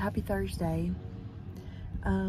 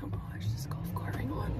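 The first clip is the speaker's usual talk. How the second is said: whispering; 8 dB quieter than usual. Real, not acted. Bumpy cart ride.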